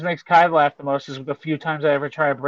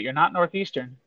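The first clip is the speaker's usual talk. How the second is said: ordinary talk, nothing marked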